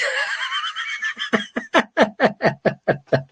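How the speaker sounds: big laugh breaking out after the bit. Real, not acted. Vlogger laughing at his own fan impression.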